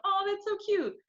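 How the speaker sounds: high, sugary mock-sweet reply. Her younger self answering the boyfriend, high and gushing. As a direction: gush it up high, faking charmed. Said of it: "I'm like".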